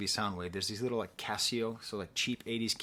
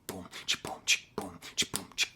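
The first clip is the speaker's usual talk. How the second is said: beatboxed rhythm; 6 semitones lower than usual. Shown as a demonstration. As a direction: tap out "Boom, chi" like a drum pattern.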